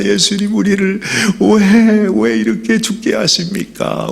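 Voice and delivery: high, desperate plea; anguished plea; pleading cry. Acted. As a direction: beg for help mid-storm. Disciples cry out in the storm.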